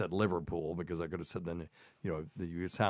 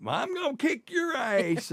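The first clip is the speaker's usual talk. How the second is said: high, slow mocking wife's voice; mocking falsetto comeback; imitating wife; 5 dB louder, slower than usual. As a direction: throw the threat back in a high voice. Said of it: "And Tacy was like".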